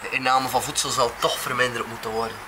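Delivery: normal talking voice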